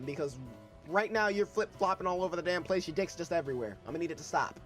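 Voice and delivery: usual speaking voice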